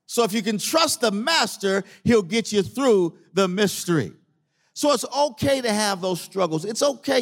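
high-pitched, emphatic sermon delivery; preaching. Real, not acted. Preaches to the congregation.

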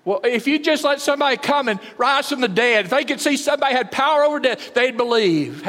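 high, loud, urgent pleading; pleading bargain. Retold or imitated. Rich man in the pit bargaining with Abraham, pleading. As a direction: bargain desperately for a sign. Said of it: "he said".

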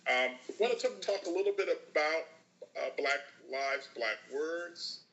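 distant tinny line; like talking through a tin can far away; tin can phone. Real, not acted. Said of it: "I sound like I'm talking through a tin can about thirty feet away".

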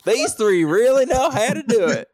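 high, loud Southern twang imitation. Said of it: "That was like [name]".

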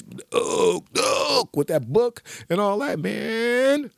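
croaked, breathy monster call; Babadook voice. Comic recalling the Babadook's creepy call. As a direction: rasp the monster's name like a creepy whisper. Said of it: "That motherfucking voice".

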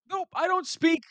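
ordinary talk, nothing marked

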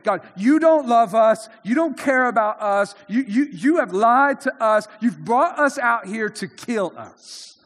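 loud, bitter complaint at God; accusing grumble. Retold or imitated. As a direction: hurl the accusation, then slow down at the end. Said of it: "they said".

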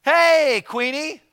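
loud, high casual shout; mock-cocky yell at a head of state; brash greeting. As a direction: barge in yelling like old pals. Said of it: "I'm not gonna walk in and go".

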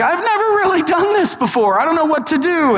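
high-pitched awkward confession; Flash. Acted. Awkward Flash confessing to Batman before battle, high nervous voice. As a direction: blurt it out high, awkward and unsure. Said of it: "Flash has this moment of honesty 'cause he's pretty awkward".

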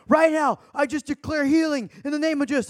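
high-pitched, forceful imitation of a prayer declaration; hammed-up faith-healer proclamation; mock healing declaration. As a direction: declare it like an overzealous beginner healer. Acting out his first healing declaration.

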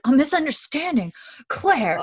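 high, incredulous echo rising in outrage; indignant disbelief. Retold or imitated. Roger to Claire over her excuse for the beating, indignant disbelief. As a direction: throw the word back, high and affronted.

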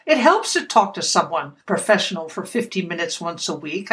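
usual speaking voice

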